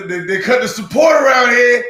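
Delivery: loud complaint. Real, not acted. Complaining, bursting out.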